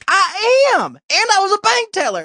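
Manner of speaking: put-on high-pitched chirpy old lady. Old lady tells the comic about her job in a high chirp. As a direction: pipe up like a proud old lady.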